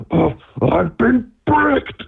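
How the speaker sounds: slow, high, dazed character voice; mock dazed voice. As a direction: say it like a stunned cartoon victim. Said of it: "Like, it's like".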